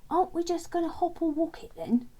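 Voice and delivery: high, loud puzzled question; puzzled challenge, pitched well up; Len quizzing. Acted. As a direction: question the plan as if it's obvious.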